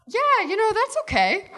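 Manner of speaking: high, lightly voiced boy letting it go; easygoing boy. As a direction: reassure casually in a high voice. Acts Lee's easygoing reply after rejection.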